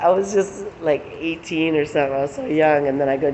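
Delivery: ordinary delivery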